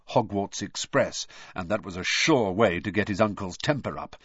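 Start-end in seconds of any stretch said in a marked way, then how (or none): none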